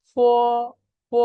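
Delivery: held ফ from slightly inside the mouth. Shown as a demonstration. Teacher shows learners where f comes from, long inner ফ. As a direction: draw ফ out from within the mouth. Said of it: "একটু ভিতর থেকে".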